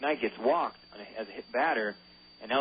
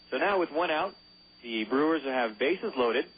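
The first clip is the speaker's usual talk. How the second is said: ordinary talk, nothing marked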